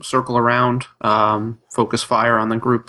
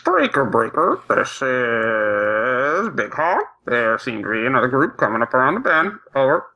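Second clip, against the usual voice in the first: CB trucker radio call; over walkie talkie. Pretend. CB radio report to party.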